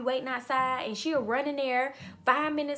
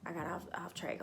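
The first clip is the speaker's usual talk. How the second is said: muttered aside; 6 semitones lower, 10 dB quieter, faster than usual. Real, not acted.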